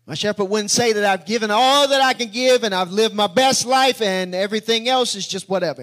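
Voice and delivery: loud, driving sermon line; full-force preaching. Acted. Preacher rejecting what the shepherd would never say, full-force preaching. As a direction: preach it out hard in one run.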